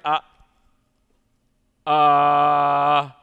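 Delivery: long, loud, drawn-out mock uh; imitated blank child; clueless kid. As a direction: hold the uh like a kid with no answer. Imitates boy lost for answer.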